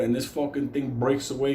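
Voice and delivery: ordinary delivery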